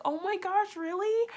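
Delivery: put-on like meeting your childhood idol; starstruck gasp. Hearing she is Wednesday Addams.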